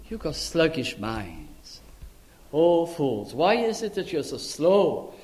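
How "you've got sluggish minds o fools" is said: ordinary delivery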